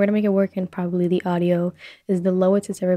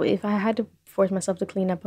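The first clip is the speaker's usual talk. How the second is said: ordinary delivery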